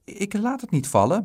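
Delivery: put-on high, loud boyish protest; high-voiced, indignant insistence; insisting child. A narrator's reading. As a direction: protest like a small boy, pitched right up. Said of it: "beweerde Dick".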